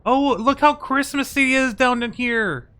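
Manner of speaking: high-pitched, loud delighted gushing; delighted squeal. Real, not acted. Delighted by festive Christmas map.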